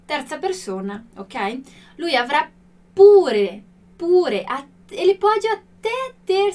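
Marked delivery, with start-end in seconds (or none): 2.0-4.4 pure punched hard and loud; stress on pure. Shown as a demonstration. Teacher to students, stressing pure loudly. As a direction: hit the word pure. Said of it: "esse pure com esse sentido de até".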